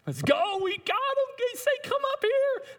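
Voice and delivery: very high, excited jeering voice; gleeful taunt. Acted. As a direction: crow with glee at the enemy.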